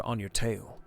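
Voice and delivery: normal talking voice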